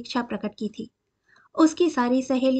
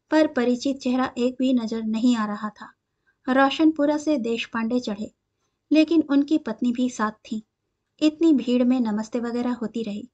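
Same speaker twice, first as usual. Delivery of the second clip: ordinary delivery, nothing marked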